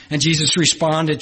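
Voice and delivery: usual speaking voice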